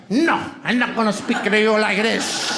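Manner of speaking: a hint of a heavy Spanish accent; imagined accent. Comic imagining his wife, lightly accented. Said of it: "but in my head".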